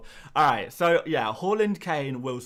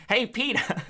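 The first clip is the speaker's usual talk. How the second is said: higher, louder cartoon wife calling out; Family Guy Lois calling Peter; Lois Griffin; 9 semitones higher, 5 dB louder than usual. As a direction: nag Peter in a shrill cartoon mom voice. Lois impression.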